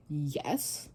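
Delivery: a cold one-word reply; dry yes. Retold or imitated. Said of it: "low-key dry".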